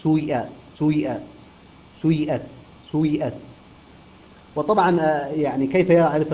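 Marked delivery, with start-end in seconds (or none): 0.0-3.3 سويئة with a brief u gliding into long i; ishmam. Shown as a demonstration. Teacher demonstrating Qalun's reading, سويئة with u-tinted i. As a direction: tint the kasra of سويئة with a short damma first. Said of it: "يقرأها بإشمام الكسرة ضما".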